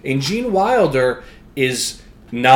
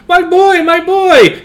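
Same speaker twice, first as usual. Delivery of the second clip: much higher, louder mock mother's wail; mom's wailing; 9 dB louder, slower than usual. Augustus's mother crying for her son in the river, high mock wail. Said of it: "Augustus' mom's going".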